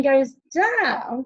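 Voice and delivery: long swooping fall on DOWN; falling pitch. Shown as a demonstration. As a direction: slide slowly down on DOWN. Voice follows the falling line.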